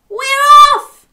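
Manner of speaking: very high, loud whoop; cheering. A narrator's reading. Dog setting off on the road trip, loud high cheer. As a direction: shout it out as a joyful cheer. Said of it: "cheered Dog".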